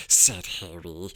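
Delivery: plain reading voice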